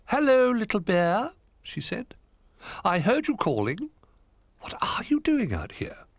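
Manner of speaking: high, loud owl voice; a kindly owl calling down. A narrator's reading. Hoot greets Little Bear, high and loud.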